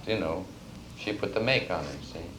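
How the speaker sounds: ordinary talk, nothing marked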